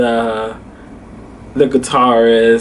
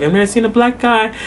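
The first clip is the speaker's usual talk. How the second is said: higher-pitched imitation of an amazed lady; surprised; 8 semitones higher than usual. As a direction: retell her astonishment in a raised pitch. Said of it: "the lady was just so surprised".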